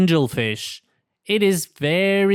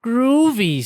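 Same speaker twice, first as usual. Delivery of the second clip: higher, louder cool cat approval; groovy delight; 7 semitones higher, 3 dB louder than usual. Acted. Pete answers the captain, pleased. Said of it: "says Pete".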